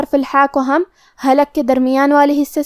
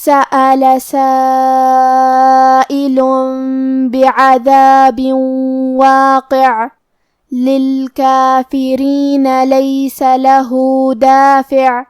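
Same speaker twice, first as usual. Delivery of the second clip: slow, drawn-out tajweed chant; Quran recitation; much slower than usual. Acted. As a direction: recite the verses slowly and melodically. (قرآن کی تلاوت، آہستہ اور لمبی)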